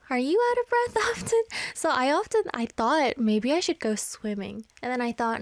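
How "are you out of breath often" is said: laughing question. Real, not acted. Amused self-question.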